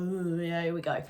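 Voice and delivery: low, dismissive eye-roll voice; Marco scoffing. Retold or imitated. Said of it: "completely scoffed at me and rolled his eyes".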